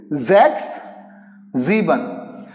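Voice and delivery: "Zex" and "Sieben" begun with voiced z. Shown as a demonstration. Teacher gives examples, "Zex" and "Sieben" with z. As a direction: buzz the z opening "Zex" and "Sieben". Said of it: "you will pronounce it as Z".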